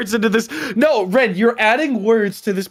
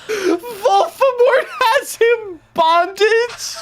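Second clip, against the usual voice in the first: high, slow, broken by laughter; can't get through it for laughing; laughing shout; 14 semitones higher, much slower than usual. Real, not acted. Cracking up while reading.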